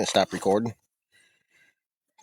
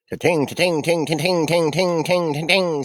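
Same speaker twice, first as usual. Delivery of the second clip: rapid sung ting-ting pings, imitating a phone buzzing; phone notification pings. Telling viewers how the phone blew up, imitated pings. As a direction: mimic the phone blowing up with pings. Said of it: "Sound like a two-stroke".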